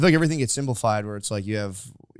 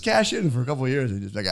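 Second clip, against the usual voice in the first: ordinary talk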